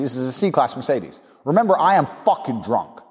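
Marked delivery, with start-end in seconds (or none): none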